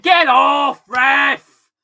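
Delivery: loud, high angry shout; yelling at the ref. Real, not acted. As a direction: bellow at the referee. Yelling at the referee.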